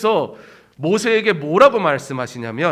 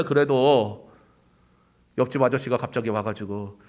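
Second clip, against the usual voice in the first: ordinary talk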